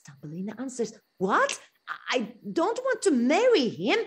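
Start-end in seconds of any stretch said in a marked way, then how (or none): 1.2-4.0 higher, louder, dismayed refusal; shocked protest. Acted. Thumbelina refuses to marry the mole, higher and dismayed. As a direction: recoil from the idea.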